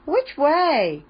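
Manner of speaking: calling out. A narrator's reading. Gran asking Chip for directions mid-race, high call. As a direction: call the question out to a passenger. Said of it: "called Gran".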